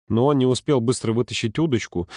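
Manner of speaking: usual speaking voice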